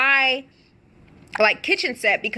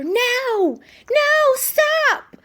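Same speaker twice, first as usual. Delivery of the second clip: much higher mock cry of fear; imitated scream; much slower than usual. Victim being choked cries out, high and scared.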